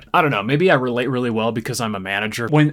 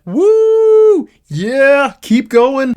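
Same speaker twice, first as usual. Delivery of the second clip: much higher and louder whooping hype; mock thrilled cheering; 11 semitones higher, 8 dB louder, much slower than usual. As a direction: cheer it on like a hyped fan, hammed up. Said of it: "everything with Matthew McConaughey in space is like".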